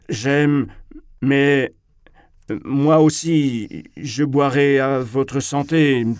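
high, loud, halting declamation; strained public speech with dragged words; floundering speech. A narrator's reading. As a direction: push on through a toast while losing the thread. Frightened speaker flounders through his toast.

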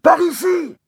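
high loud call; father in the pit calling to his son. Acted. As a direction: guide him with your voice.

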